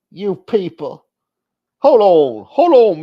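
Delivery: high, loud put-on outrage; mock outrage. Host parodying offence at "you people", mock outraged. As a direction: cut in loudly, acting deeply offended.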